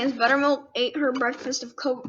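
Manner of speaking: ordinary delivery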